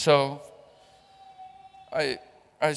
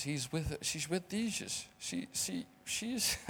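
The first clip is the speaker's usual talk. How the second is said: grief-choked; 2 semitones higher, 13 dB quieter than usual. Real, not acted. Mourning his mother.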